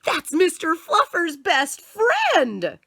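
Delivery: high, slow, loud wail of dismay; horrified child. Acted. Boy to mother over his bunny, loud slow horrified child. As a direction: gasp it out like a kid betrayed.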